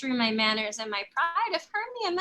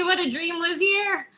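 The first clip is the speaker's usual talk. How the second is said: ordinary talk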